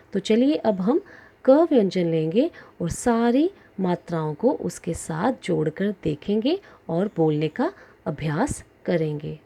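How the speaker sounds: normal talking voice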